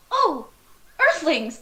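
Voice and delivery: tiny alien thrilled to find Earthlings; excited alien. Acted. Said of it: "And it's so excited".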